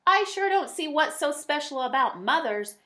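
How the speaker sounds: high, loud angry yell. Acted. Milo yelling at his leaving mother, high and loud. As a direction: shout it after her in a fit. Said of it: "Milo yelled".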